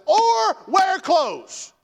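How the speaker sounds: high, slow, drawn-out shout; sarcastic shout. Retold or imitated. Preacher mocking the misreading with a shouted retort. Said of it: "And I said".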